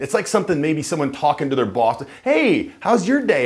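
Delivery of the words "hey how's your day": high, eager tune twirling up at the end; rapport-seeking tune. Shown as a demonstration. Employee greeting the boss, high and twirling up. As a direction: sound like you're sucking up to the boss. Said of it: "ends with a twirl up at the end".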